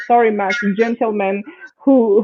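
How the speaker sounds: ordinary delivery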